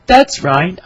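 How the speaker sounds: usual speaking voice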